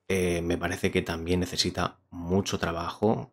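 voz de presentador, pausada; reseña a cámara. Acted. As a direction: cierra el vídeo con la opinión. Closing review to camera. (tono de reseña)

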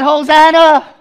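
high, very loud hosannas; crowd's praise cry. Acted. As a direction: shout it like the palm-waving crowd. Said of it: "They said".

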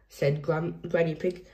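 plain reading voice

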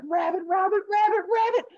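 very high, urgent chant; high, loud frantic patter; frantically running rabbit. Acted. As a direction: squeak along as a cartoon rabbit at a full sprint. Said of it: "runs as fast as he can".

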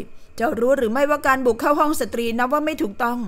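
usual speaking voice

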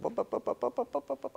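high rapid bup bup sounds to cut someone off; playful shushing noise; hushing bups. Retold or imitated. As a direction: stop his panic with quick high bups. Calming panicking son.

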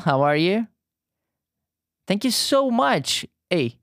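ordinary delivery

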